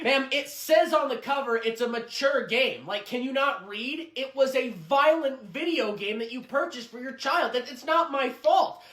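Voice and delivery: high-pitched, mounting exasperation; exasperated arguing. Acted. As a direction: argue back, voice climbing. Clerk argues back, exasperated.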